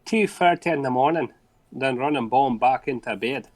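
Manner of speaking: storytelling in a put-on Scottish accent. Shown as a demonstration. Co-host reading a listener's mishap to the host, Scottish accent. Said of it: "You do a good Scottish accent".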